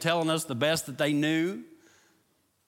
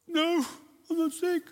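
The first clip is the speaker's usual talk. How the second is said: put-on high, small child's voice; little boy's reply; 13 semitones higher than usual. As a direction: answer like a hurt little kid. Boy answering his dad.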